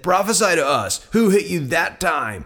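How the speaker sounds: high, jeering demand; mocking taunt. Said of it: "began mocking and beating him".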